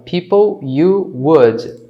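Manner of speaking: PEOPLE YOU WOULD in separate words; half-speed model of the uncontracted words; slow full form. Shown as a demonstration. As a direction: keep YOU and WOULD apart, slowly. Teacher reads full form slowly.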